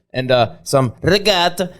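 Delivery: slow, hammed-up Italian; a lightly put-on Italian accent; mock Italian. Imitating his mom ordering with a mild Italian accent. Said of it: "she's like fucking Borat".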